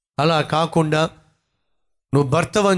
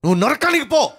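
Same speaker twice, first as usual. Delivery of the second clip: boss's curse; 9 semitones higher, faster than usual. Retold or imitated. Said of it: "అన్నాడు".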